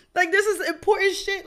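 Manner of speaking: amused disbelief, laughing. Real, not acted. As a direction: let the laugh spill through the words. Laughing while retelling her outrage.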